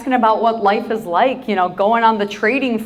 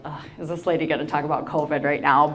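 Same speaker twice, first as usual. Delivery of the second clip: low, soft mock-weary grumble; imagined audience groan; 5 semitones lower, 5 dB quieter, faster than usual. Speaker voices the audience dreading covid talk, mock-weary groan. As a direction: groan it quietly for the whole crowd. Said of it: "collectively like".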